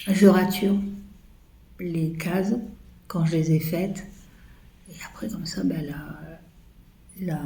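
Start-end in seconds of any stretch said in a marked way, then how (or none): 4.9-7.4 soft whisper, half to herself; whispered aside. Real, not acted. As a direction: murmur it confidentially. Confiding aside. (chuchotement en aparté)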